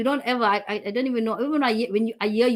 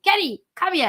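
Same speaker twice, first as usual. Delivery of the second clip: calling out; 8 semitones higher than usual. Retold or imitated. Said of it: "I'll be screaming".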